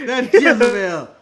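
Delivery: loud, slow mock commentator outrage. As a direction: drag out the announcer's condemnation, imitated. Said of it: "J R just going".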